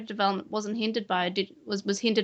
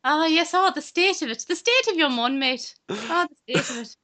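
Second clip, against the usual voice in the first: mock Irish accent; 6 semitones higher than usual. Teasing co-host in a hammed-up Irish accent. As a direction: ham up an Irish accent, pitched high. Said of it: "I can do a really good Irish accent".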